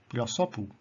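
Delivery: "pilosopu" with the accent on SO; stress on second-last syllable. Shown as a demonstration. Teacher repeating an example, "pilosopu" stressed on SO. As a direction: lean on SO in "pilosopu". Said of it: "the accent is upon the second last syllable".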